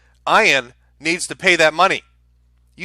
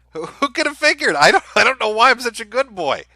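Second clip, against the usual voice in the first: sarcastic, much higher voice; higher, sarcastic "who could have guessed"; mock innocence; 8 semitones higher than usual. Real, not acted. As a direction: play the wide-eyed innocent with heavy irony.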